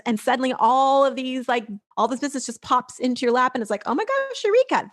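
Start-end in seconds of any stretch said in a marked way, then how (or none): 3.9-4.9 much higher, squealing mock delight; mock eureka. Mocking people who expect business in their lap, high mock glee. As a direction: gush over sudden overnight success. Mocking instant-success fantasy.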